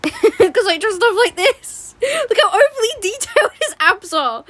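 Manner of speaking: high squealing laughter; laughing. Real, not acted. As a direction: talk through giggles.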